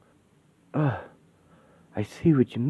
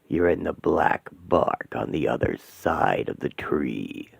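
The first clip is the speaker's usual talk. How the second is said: very low, slow, hollow demonic voice; 10 semitones lower, 5 dB quieter, slower than usual. Acted. Demon Asmodeus greeting the trapped women, low and menacing. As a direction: loom over them like a demon.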